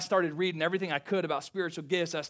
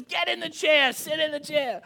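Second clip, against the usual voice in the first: bossy mom; 9 semitones higher than usual. Retold or imitated. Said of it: "She goes".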